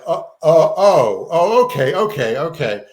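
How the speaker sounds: loud startled oh, then settling okays; stunned realization. Retold or imitated. Reading the article, he's stunned, a retold startled gasp. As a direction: catch yourself, then accept it.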